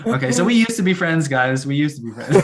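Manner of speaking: jokingly disown a friend, breaking into laughter; amused, hammed-up hurt; mock falling-out. Jokingly ends friendship over the mistake.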